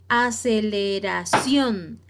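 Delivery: Aceleración drawn out, weight on the final "ción"; slow teacher's Aceleración, last syllable strongest; stress on last syllable. Shown as a demonstration. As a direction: spell out Aceleración slowly, landing on "ción". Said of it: "la sílaba tónica más fuerte es la última".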